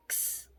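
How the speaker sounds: क्स as a voiceless "ks"; k straight into "sss" for क्स, very soft; k and s together. Shown as a demonstration. Teacher repeating the X sound, क्स as bare "ks". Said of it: "क और स एक साथ".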